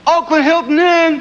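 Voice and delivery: high, loud call across the curb; calling out to arriving guests from the bus; bellhop yell. Retold or imitated. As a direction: bellow it out like a hotel bellhop. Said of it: "the airport and yell".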